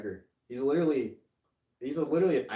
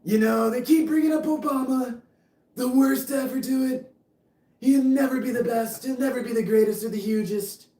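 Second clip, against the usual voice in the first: much higher, louder put-on braggart voice; over-the-top blustering politician, pitched up and loud; boastful politician impression; 13 semitones higher, 8 dB louder than usual. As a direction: brag like a pompous president on a podium. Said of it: "your impressions are O M G".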